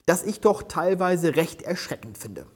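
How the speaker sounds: ordinary delivery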